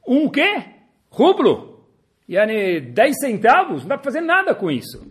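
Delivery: high, loud disbelief at a tiny sum; incredulous scoff. Acted. Shop clerk mocking a customer with one ruble, high incredulous scoff. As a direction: scoff as if the offer is a joke.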